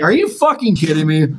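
mock exasperation. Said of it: "folding my arms".

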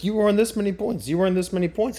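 high, bright-voiced app reading out points; mock cheery app announcement; app notification voice. Reading the app's points message in a high mock voice. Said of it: "it's like".

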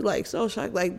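usual speaking voice

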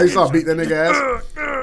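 usual speaking voice

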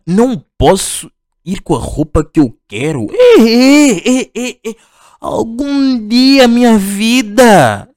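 high, drawn-out sulky whine; mock whiny complaint. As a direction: whine it like a spoiled kid. Mocking tuna dress rules. (queixa mimada e arrastada)

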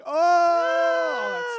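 put-on high, loud whooping "oh" from the boys; mock crowd of boys jeering "Oh!"; teasing group shout. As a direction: whoop it up like a cabin full of boys. Boys tease about the crush.

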